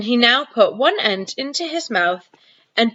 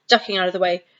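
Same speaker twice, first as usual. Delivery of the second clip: ordinary delivery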